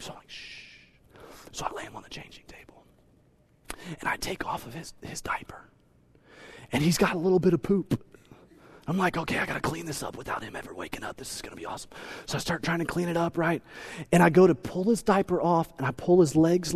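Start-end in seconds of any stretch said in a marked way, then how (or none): 0.3-0.8 shush. Retold or imitated. Hushing sleeping baby. 1.5-5.6 whispered narration, barely voiced. Dad whispering over a sleeping baby, hushed whisper. 9.2-11.9 quick hushed whisper to himself. Retold or imitated. Dad whispering to himself at the changing table, quick whisper. Not waking the baby.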